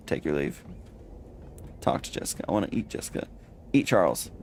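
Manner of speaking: usual speaking voice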